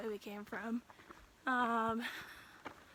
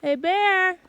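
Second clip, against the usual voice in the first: loud high shout; scaring off a bear; 8 semitones higher, 15 dB louder than usual. Real, not acted. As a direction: yell hard to scare a bear. Calling out to scare a bear.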